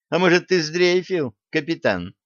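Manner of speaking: high-pitched, taunting question; snorted challenge, pitched well up; scornful snort. A narrator's reading. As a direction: tease him scornfully in a high girl's voice. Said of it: "фыркнула девчонка".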